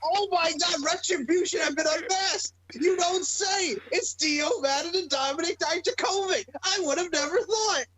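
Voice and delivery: very high, strained fake shock; hammed-up disbelief; mock astonishment. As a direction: squeal as if stunned, heavily sarcastic. Mocking the unmasking reveal.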